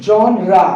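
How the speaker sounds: genre as 'zhon-ra'; correct pronunciation. Shown as a demonstration. Teacher confirms the correct pronunciation, carefully said. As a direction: end genre on an open 'ra'. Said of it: "This is the correct pronunciation".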